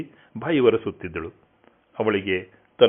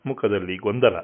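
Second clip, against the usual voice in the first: plain reading voice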